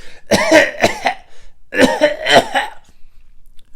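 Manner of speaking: loud high fake retching cough; hammed-up gagging noise; loud fake throw-up to get noticed. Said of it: "and I'd be like".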